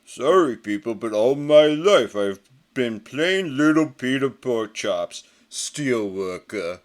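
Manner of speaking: a big lug brushing everyone off; gruff brute's farewell. Acted. Pig Iron's voice for his exit.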